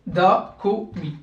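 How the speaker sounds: DA, KO, MI with short clipped vowels; short syllables. Shown as a demonstration. Teacher showing short syllables to learners, brief vowels in "Da, ko, mi". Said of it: "syllabes courtes".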